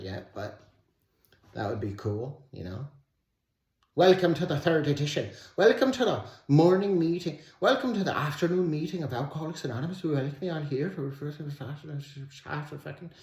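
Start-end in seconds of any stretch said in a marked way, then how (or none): 4.0-13.1 put-on cheesy caricature Irish accent rattling off a welcome; mock Irish accent. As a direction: welcome everyone in a put-on Irish lilt, then stumble. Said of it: "that accent is cool as shit".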